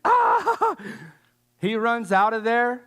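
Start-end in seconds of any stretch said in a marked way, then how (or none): 0.0-0.8 high squealing yelp of fright; mock panicked squeal; startled yelp. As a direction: let out a sudden high squeal. Joseph fleeing in retold story.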